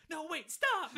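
high, loud mock-desperate yell; strained moaning. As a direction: cry out as if straining in agony. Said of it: "you hear him moaning".

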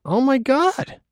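high, put-on gasping wonder; mock amazement. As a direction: pretend to be astonished. Staged amazement at Nancy's mail.

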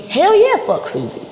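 loud, high-pitched hearty cheer; emphatic agreement. Acted. Partner backing babe against Susie, emphatic agreement. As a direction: burst out in hyped-up agreement.

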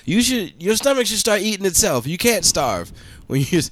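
mock ornery patient. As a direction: whine it like a spoiled patient. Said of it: "It's like".

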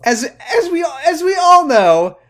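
high, loud sarcastic announcement; mock grand pronouncement. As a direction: proclaim it like obvious truth.

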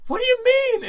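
very high, loud shriek; mock scream. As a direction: screech it in hammed-up disbelief. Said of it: "started crying and screaming at him".

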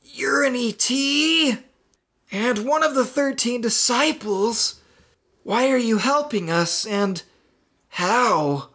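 very high, astonished questions; gasping surprise. Acted. Marty learning who the voice is, gasping surprise. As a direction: blurt it out in disbelief. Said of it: "Marty gasped".

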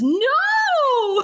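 high, pleading Huaisang cry; mock wail. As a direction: yelp out a horrified refusal. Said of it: "And Huaisang says".

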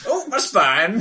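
put-on creaky old man groaning. Old man complaining bit.

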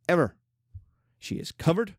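ordinary delivery

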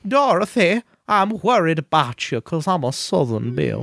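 lightly Southern Aunt Em voice; a hint of Southern aunt calling out; Aunt Em impression. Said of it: "her Aunt Em is like".

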